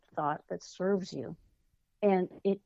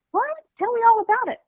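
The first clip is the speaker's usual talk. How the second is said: much higher and louder, thrilled mother; excited mom; 8 dB louder, faster than usual. Retold or imitated. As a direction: gush with eager delight. Mom reacting to daughter's news.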